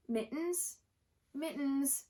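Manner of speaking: a mild call into the dark. A narrator's reading. Girl peers under a porch for the lost cat, calling. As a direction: call the cat's name, searching. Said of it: "she called".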